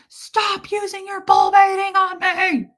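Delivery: high, loud exasperated yell; mock yell at her; retold scream. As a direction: shout it as the fed-up partner. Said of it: "He'd scream at me".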